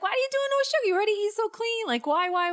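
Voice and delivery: high-pitched imitation of nagging friends; mimicked high-voiced whining questions; mock pestering.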